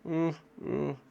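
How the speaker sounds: high anxious little oohs; worried fretting. Shown as a demonstration. Teacher acting out feeling worried, anxious oohs. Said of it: "I was worried".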